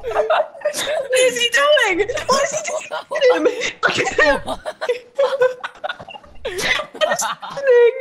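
talking through laughter; cracking up between words; laughing hard. Real, not acted. Laughing at a teammate's blunder.

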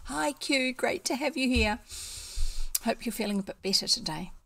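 ordinary delivery, nothing marked

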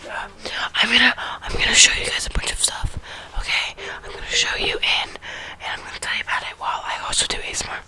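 ASMR show and tell whisper. Real, not acted.

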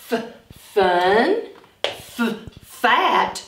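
each f stretched before the word; sounded out. Shown as a demonstration. Teacher sounding out f words for children. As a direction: hiss the f, then say the word. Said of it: "we have the f sound".

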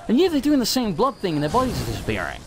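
ordinary talk, nothing marked

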